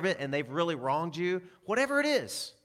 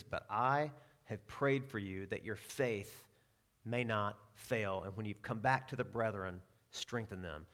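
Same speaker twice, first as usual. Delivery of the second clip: tender prayer; 5 semitones lower, 6 dB quieter than usual. Retold or imitated. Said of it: "he says".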